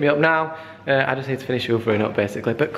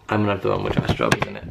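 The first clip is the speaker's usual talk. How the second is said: much lower, quick goofy voice; deep silly voice; 13 semitones lower, faster than usual. Pretend. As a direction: drop into a comic low voice.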